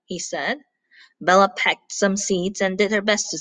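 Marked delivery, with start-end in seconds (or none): none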